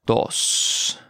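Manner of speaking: "Dos" drawn out slowly; slow model. Shown as a demonstration. Teacher modelling vocabulary for learners, slow "Dos". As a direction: stretch out each sound of "Dos".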